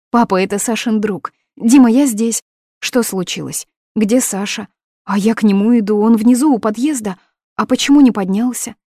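high, loud, urgent questions; girl calling to her fiancé's friend, high and loud; anxious calling out. Acted. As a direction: call down to him, worried. Calls out to friend.

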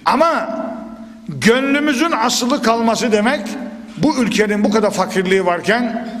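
usual speaking voice

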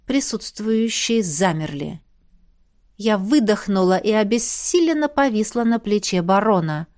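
usual speaking voice

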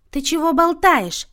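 high, loud, slow indignant challenge; snapping angrily. A narrator's reading. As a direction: pounce on the speaker with outrage. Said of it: "накинулась на неё Люся". (сердито, набрасываясь)

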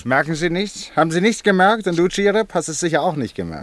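triumphant detective teasing his friends; excited. Acted. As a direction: push them to see what you see. Triumphant reveal to puzzled friends.

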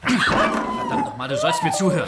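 he's had enough and explodes at her; bellowing in rage to make her listen; furious shout. Acted. Man loses temper with Dulcie.

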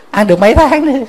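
husband venting at his wife to the monk, loud and high. Retold or imitated.

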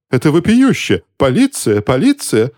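high-pitched scandalised outcry; exclaiming. A narrator's reading. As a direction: cry out high, calling for police. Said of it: "восклицал кто-то".

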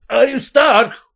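high, slow furious shout. A narrator's reading. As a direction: bellow the son's name. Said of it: "стал он звать сына". (отец в ярости кричит имя сына)

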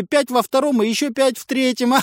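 high, strange mocking voice. A narrator's reading. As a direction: tease from somewhere unseen. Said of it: "Хохотал голос".

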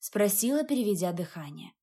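ordinary delivery, nothing marked

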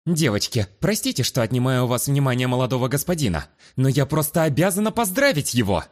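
high, eager, rattling it off; chattering brightly. Acted. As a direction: rush in, all excitement. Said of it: "продолжала тараторить".

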